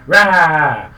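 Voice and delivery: loud, high kitten roar; high, loud "rah"; tiny cat's roar. Acted. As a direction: let out a loud little battle cry as a tiny cat. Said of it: "he goes".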